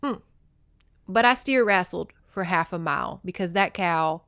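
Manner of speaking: ordinary delivery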